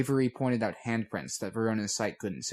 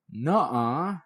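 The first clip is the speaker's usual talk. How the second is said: protesting; 4 semitones higher, 6 dB louder than usual. A narrator's reading. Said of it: "Snowdrop made a sound of protest".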